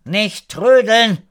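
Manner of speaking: high, loud, drawn-out call; slow singled-out shout to a dawdler. A narrator's reading. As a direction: hurry him along from afar. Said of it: "rief Frau Radek".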